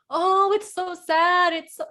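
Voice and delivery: high, slow, overdone sad voice; mock wailing over a loss; mock grief. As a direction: ham up the sorrowful mourner. Mocking typical grieving reaction.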